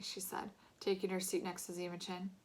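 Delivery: plain reading voice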